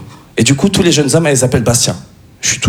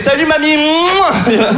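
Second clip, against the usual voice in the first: gushing grandson greeting; 12 semitones higher, much slower than usual. Acted. Student nurse greeting the old lady as her grandson, gushing.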